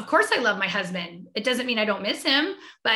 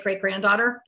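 ordinary talk

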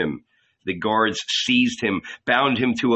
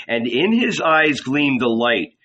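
plain reading voice

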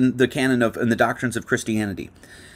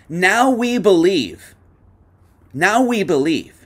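Now stretched slow and high; emphatic reading; 7 semitones higher, 4 dB louder, slower than usual. Shown as a demonstration. Preacher reading scripture to the congregation, slow emphatic Now. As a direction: lean hard on Now. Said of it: "repeats verse for emphasis".

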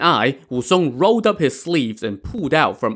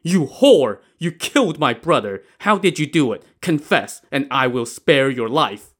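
raised, angry interrogation; glowering accusation; 3 semitones higher than usual. Acted. Brother accuses his sister-in-law of murder, angry demand. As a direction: burst out, then ease into a stern demand. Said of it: "turned and glowered at Pan Jinlian".

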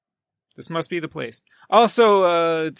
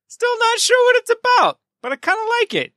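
pitched way up, squeaky; high squeaky; 3 dB louder, faster than usual. Real, not acted. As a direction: squeak it out bemused. Amused confused shrug.

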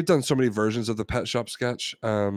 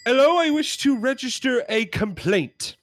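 high-pitched British customer lodging a complaint; indignant British pet shop customer; British accent; 12 semitones higher than usual. Acted. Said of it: "We're gonna try our British accents".

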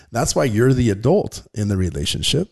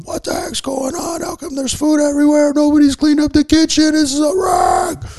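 much higher, louder hammed-up rant at the mess; mock yelling dad; 5 dB louder than usual. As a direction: play the fed-up dad blowing up over a messy kitchen. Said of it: "start yelling".

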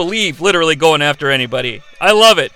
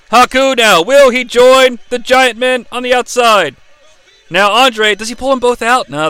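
usual speaking voice

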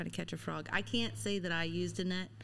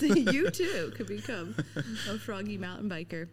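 lightly hammed-up sales pitch; playful announcer voice; mock infomercial; 3 dB louder than usual. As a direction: pitch it like a spoof TV advert. Mock advert for becoming frogging biker.

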